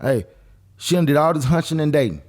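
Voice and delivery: ordinary talk, nothing marked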